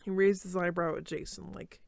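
ordinary delivery, nothing marked

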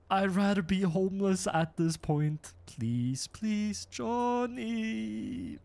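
whiny, drawn-out begging; hammed-up whine for help; mock pleading. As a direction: plead helplessly, stretching out the words. Begging Johnny to rescue him.

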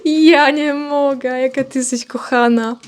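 slow, drawn-out gushing; stretched words, overcome with fondness; adoring cooing. Real, not acted. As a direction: melt with affection for a pet. Adores hen right after laughing.

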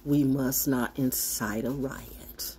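put-on slow hushed whisper of a dutiful child; mock whisper. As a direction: hush it like a scolded kid. Obedient reply to mother.

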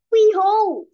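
booming reveal; grand behold. Acted. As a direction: unveil it like a magician. Hamster reveals his gadget.

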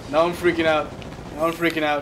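ordinary talk

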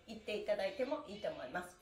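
ordinary talk

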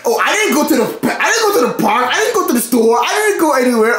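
a light mocking imitation of the son's denials. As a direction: tease him by parroting his excuses. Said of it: "you like".

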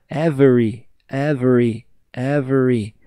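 every repeated in pieces; syllable drill. Shown as a demonstration. Teacher drilling the word for students, repeated. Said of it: "drilling syllables".

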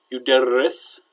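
"Yuderris" with a held, flapped double r in the middle; emphasize the middle r of "Yuderris"; doubled r. Shown as a demonstration. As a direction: lengthen the rr in "Yuderris". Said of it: "shadda on the ra".

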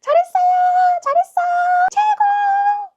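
very high, loud cheering; piercing, jubilant cheer; imitated cheering. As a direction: shout praise at the top of your range. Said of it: "하시던 분이 나중엔".

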